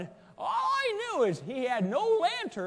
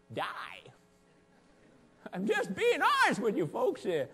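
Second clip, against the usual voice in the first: ordinary talk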